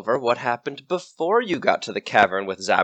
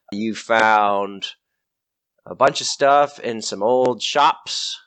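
ordinary talk

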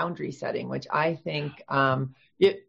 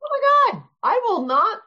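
excited exclaim; 5 dB louder than usual. Real, not acted. Excited agreement with host.

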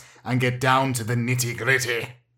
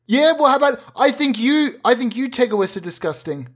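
put-on indignant teenage son; 8 semitones higher than usual. Teen son throwing it back.

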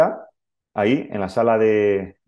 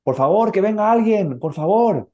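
calling for help, pitched up; mock urgent call for help; father calling out with the newborn in his arms; retold shout; 9 semitones higher than usual. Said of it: "Entonces grité".

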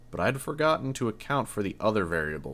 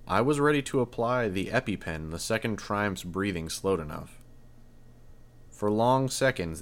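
ordinary delivery, nothing marked

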